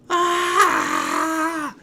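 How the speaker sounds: very high, loud shrieking war cry; a screaming animated celery swinging; celery battle cry. Acted. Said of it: "is animated itself and is going".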